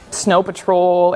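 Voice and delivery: normal talking voice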